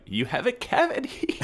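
high, giggly voice as the creepy dentist; mock dentist breaking bad news with a giggle; laughing dentist villain. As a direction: deliver the news through a laugh.

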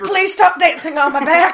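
high, loud pained plea; strained shout. Acted. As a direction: yell it under the weight. Partner dancing on his back.

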